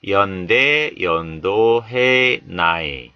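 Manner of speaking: ordinary delivery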